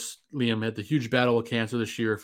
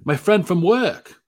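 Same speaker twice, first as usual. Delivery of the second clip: higher, slow, loud Thor impression; 8 semitones higher, 8 dB louder, slower than usual. Cohost quoting Thor's line, in a loud Thor voice. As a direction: proudly announce it like Thor.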